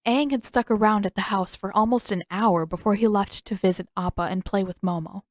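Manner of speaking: usual speaking voice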